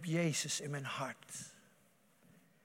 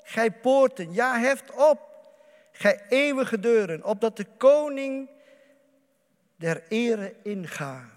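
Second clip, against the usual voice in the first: loud, higher proclaiming of the psalm; psalm recitation; 5 semitones higher, 10 dB louder than usual. Acted. Preacher reads the psalm to the congregation, proclaiming loudly. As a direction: call it out like a herald at the gates. Reading Psalm aloud, raised voice.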